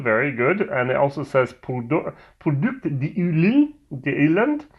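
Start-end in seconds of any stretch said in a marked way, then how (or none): 1.5-4.7 reading the label in a mock French accent; lightly put-on French accent, rolled and rising; terrible French accent. Reviewer reads whisky box label to viewers in a mock French accent. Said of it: "my French accent, which is terrible".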